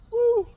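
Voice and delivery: loud high whoop; joyful shout. Real, not acted. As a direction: shout out in triumph. Shouts after bending the knee.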